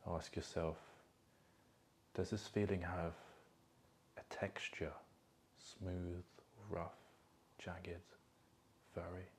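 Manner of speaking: soft, calm meditation voice with long pauses; guided meditation. Acted. Coach guiding listeners to feel emotions in their body, guided meditation. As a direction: guide them gently inward, leaving space between phrases.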